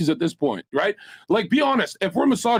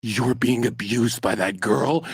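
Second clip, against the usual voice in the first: low, slow imitated officer; police officer; 11 semitones lower, slower than usual. Officer noticing the streamer's swollen lip, imitated low and slow. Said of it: "And he's like".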